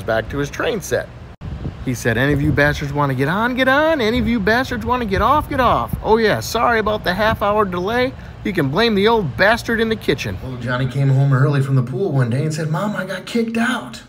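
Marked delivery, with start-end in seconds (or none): 2.2-10.4 raised, sing-out announcing voice; train conductor. Acted. Boy playing train conductor. 12.7-14.0 lightly voiced child, high and drawn out; complaining boy.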